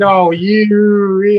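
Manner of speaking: mock indignant retort. Derek answering his teasing friend, playfully indignant. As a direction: shoot back in mock outrage at a friend. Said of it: "like that".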